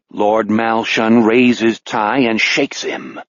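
ordinary delivery, nothing marked

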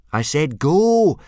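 slow, loud, high exasperated shout; boy shouting at a dragon that won't fly; frustrated yell. Acted. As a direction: stretch each word out in exasperation. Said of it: "Hiccup repeated in frustration".

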